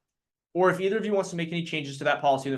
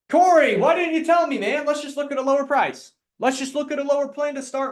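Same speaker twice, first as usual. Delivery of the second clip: much higher and louder, eager and friendly; eager reassuring agent; 9 semitones higher, 5 dB louder than usual. Shown as a demonstration. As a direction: sound thrilled to help the client. Agent reacting to client's budget.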